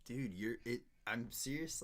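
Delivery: usual speaking voice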